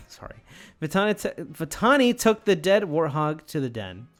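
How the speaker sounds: ordinary talk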